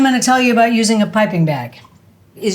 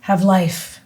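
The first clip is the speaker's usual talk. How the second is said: slow, grave Shakespeare reading; dramatic recitation; 3 semitones lower than usual. Acted. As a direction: recite it like a tragic actor. Recites King Lear.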